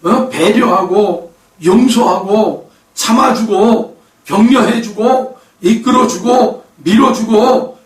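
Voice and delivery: slow preaching list. Acted. Preacher to congregation, slow building list. Preacher lists love's virtues.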